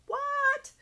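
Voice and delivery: very high, loud startled yelp; surprised what. Retold or imitated. Reader reacting to the client's sudden energy, startled yelp. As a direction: jump up in pitch, shocked. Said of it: "And I'm like".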